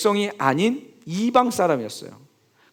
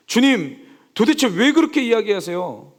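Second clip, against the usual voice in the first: mock demanding protest; 7 semitones higher than usual. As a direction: press the Lord for answers in a staged argument. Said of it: "따지지 않았습니다". (주님께 따지는 흉내)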